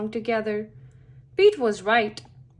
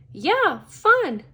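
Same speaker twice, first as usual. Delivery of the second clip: high, louder burst of enthusiasm; eager, high-pitched agreement; enthusiastic character; 7 semitones higher, 6 dB louder than usual. A narrator's reading. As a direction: pop each word up brightly in pitch. Pete enthuses to friend in story.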